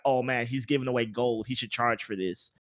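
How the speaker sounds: ordinary talk, nothing marked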